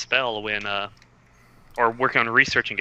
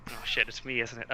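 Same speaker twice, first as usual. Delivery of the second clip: normal talking voice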